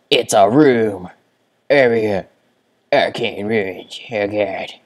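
loud slow delighted exclaim; excited shout. Real, not acted. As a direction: call it out thrilled. Excited discovery.

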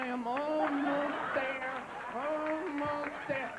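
drawn-out, very slow straining; straining slowly. Pretend. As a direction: stretch each word like you're nearly done.

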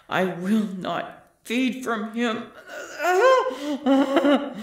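crazed laugh. Acted. Hunger overwhelming her.

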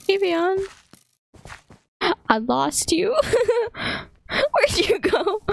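high, strained calls for a lost friend; panicked high calling. Real, not acted. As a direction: call out high for your teammate, half laughing. Lost, calling for teammate Evian.

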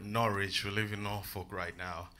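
ordinary talk, nothing marked

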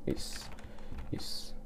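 barely voiced whisper. Real, not acted. Player whispering encouragement to himself mid-fight. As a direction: whisper it under the breath. Tense moment in game.

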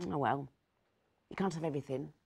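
plain reading voice

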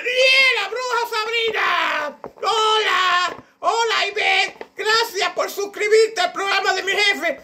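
very high cackling witch voice, shouted greetings. Acted. The witch Sabrina thanks new subscribers in a shrieking witch voice. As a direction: squeal it out like a cartoon witch. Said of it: "de bruja o de Sabrina".

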